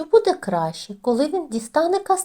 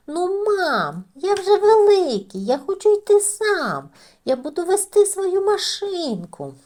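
put-on higher, louder whiny child; whiny little mouse; 9 semitones higher, 4 dB louder than usual. A narrator's reading. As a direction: plead like a kid who wants to go alone. Дитина сперечається з мамою. (вередливе мишеня до мами вищим голосом)

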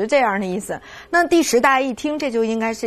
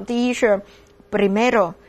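老师一个个慢慢领读序数词; 放慢语速逐个清楚地读出来; 慢速领读; slower than usual. Shown as a demonstration. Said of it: "再来一遍哈". (带着学生复习的慢速领读)

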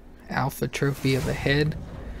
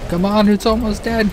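louder, higher urgent push; being chased; 7 semitones higher, 6 dB louder than usual. Real, not acted. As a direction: urge it on, breathless with urgency. Frantic fight with fleeing boss.

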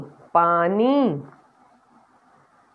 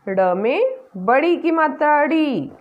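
ordinary talk

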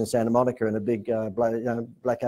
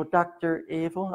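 Doctor Evil; 6 semitones higher, 4 dB quieter, slower than usual. Retold or imitated. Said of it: "I've been Doctor Evil up until then".

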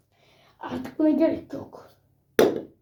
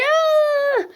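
scream; 10 semitones higher, 3 dB louder than usual. Acted. Being eaten.

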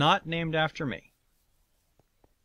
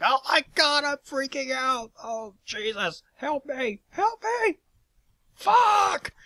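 panicked freak-out; 5 dB louder, slower than usual. Retold or imitated. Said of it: "It's like".

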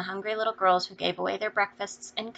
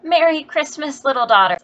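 put-on mother warmly answering the girls' greeting; motherly greeting; 7 semitones higher, 11 dB louder than usual. A narrator's reading. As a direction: greet her daughter with open affection.

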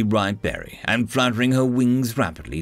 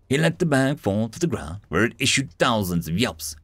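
usual speaking voice

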